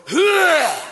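put-on high loud old-man "Huh!"; grandfather's phone yell. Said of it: "for your grandfather that goes".

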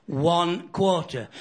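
One quarter, drawn out very slowly; slow emphasis. Shown as a demonstration. Comic repeating the figure, slow and weighted. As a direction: lean on each word of One quarter.

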